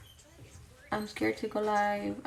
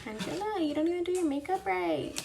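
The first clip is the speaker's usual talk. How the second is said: higher, teasing self-scold; mock self-scolding; 7 semitones higher than usual. Real, not acted. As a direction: tease yourself like a nagging friend. Teases herself by name.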